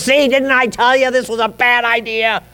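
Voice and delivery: a hint of a smug complainer; mild told-you-so whine; Dathan. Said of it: "And then Dathan says".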